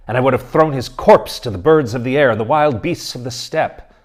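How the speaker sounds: father vowing vengeance over his dead daughter; vengeful vow. Acted. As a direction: swear revenge, building in force.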